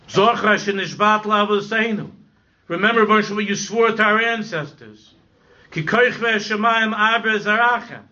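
rising impassioned plea to God; fervent preaching. Acted. Pleading preacher prays aloud.

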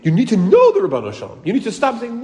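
usual speaking voice

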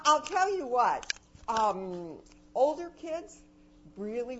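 ordinary talk, nothing marked

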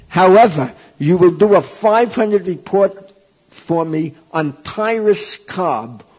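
loud, firm teacher's ultimatum, slowing at the end; spring the catch on the student, loud and slow; stern teacher's demand. Retold or imitated.

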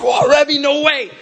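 high, loud astonished greeting; sudden delight at seeing the rabbi; surprised whoa. Retold or imitated. Surprised meeting at airport.

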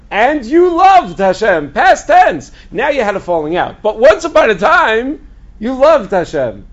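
loud, high, drawn-out mock proclamation; mock declaiming. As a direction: proclaim it slowly as a hammed-up absurd reading. Mock declaiming of a wrong translation.

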